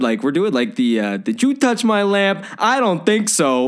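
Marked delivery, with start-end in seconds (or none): 1.2-3.7 high, cartoonish genie with New York accent; Robin Williams. Likening the actor's accent to a genie, high hammed-up New Yorker. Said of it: "the Robin Williams genie impression".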